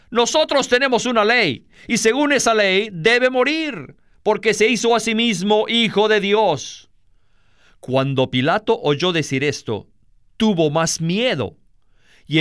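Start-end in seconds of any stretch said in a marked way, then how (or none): 0.1-6.9 high-pitched, pressing accusers; insistent accusation. A narrator's reading. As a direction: press the charge with a raised pitch. Accusers insist before Pilate. (acusación insistente en tono agudo)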